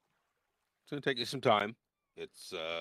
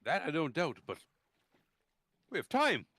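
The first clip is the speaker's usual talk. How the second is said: put-on higher, insistent old fellow; Dalamar voice; 8 semitones higher than usual. Dalamar refusing the written story, high insistent voice. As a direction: cut him off eagerly, wave the book away.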